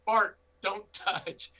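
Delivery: firm command. Retold or imitated. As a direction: stretch each word out like scolding a dog. Owner commands bear while on phone.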